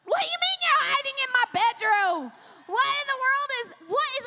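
high, loud retold shock; mock alarmed disbelief. Mom on the phone to the hiding babysitter, acted alarm. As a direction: demand an explanation in hammed-up disbelief.

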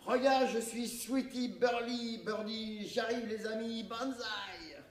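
high, slow hammed-up yell; shouting. JP shouts from the crane while taking a selfie, high and hammy. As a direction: yell like a daredevil clowning.

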